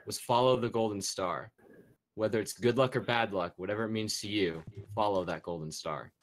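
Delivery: story narration. Acted. Tells the pirate crew backstory.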